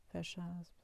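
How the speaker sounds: soft closing cue; dictating. Acted. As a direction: drop it quietly. Dictating quote marks.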